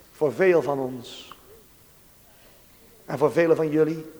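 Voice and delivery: ordinary talk, nothing marked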